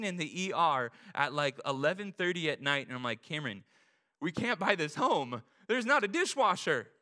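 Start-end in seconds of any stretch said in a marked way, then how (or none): 3.2-6.9 mock panic rising louder and higher; freaking out. Husband telling his wife late at night, rising mock panic. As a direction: build from calm name to panicked cry. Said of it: "freaking out over a dishwasher".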